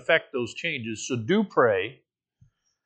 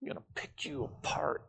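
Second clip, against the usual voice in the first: soft hushed threat, breathy and sinister; a voiced devil's whisper, very quiet; menacing whisper; 14 dB quieter than usual. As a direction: whisper it like the tempter leaning in. Voicing Satan's threat.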